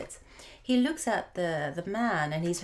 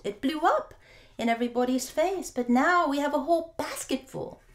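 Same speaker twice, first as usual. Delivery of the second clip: higher, louder, faster with glee; gleeful husband; 8 semitones higher, 5 dB louder than usual. Acted. Husband proudly explaining rotten apples, high and quick. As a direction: rush through it, tickled.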